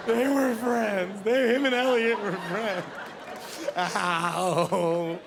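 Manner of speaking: slow, blubbering movie-watcher; mock weeping. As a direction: bawl the words out, hammed up. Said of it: "I cry, oh, E T every time".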